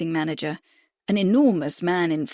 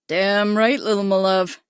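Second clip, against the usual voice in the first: lightly voiced broad Midwestern drawl, a touch louder; 4 dB louder than usual. A narrator's reading. American jockey greeting Lily, in a light Midwestern drawl. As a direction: drawl it in a Midwestern accent, cocky. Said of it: "he drawled in a broad Midwestern accent".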